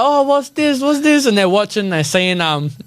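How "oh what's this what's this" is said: high, far-off mock voice of curious boys; a hammed-up curious kid; curious teens. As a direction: squeak it like nosy kids walking in. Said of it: "They're like".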